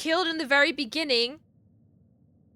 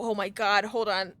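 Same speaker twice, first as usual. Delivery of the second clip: ordinary talk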